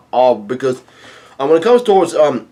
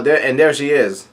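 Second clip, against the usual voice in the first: normal talking voice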